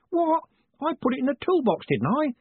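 very high, quick defensive squawk; indignant protest. Acted. Mad Owl blamed for the lost hammer, high indignant voice.

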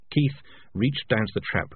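ordinary delivery

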